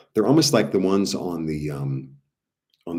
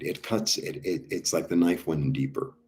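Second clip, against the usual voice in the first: usual speaking voice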